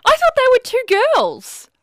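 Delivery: high, loud disbelieving cry; shocked surprise. Real, not acted. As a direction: burst out in astonishment. Shocked Basma is a girl.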